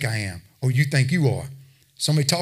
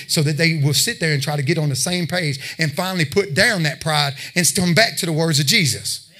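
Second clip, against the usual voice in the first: ordinary delivery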